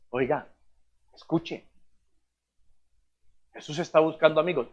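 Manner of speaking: soft, low, confiding voice; hushed invitation. Shown as a demonstration. Preacher showing how to invite a stranger, hushed. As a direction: lean in quietly, as if sharing a secret.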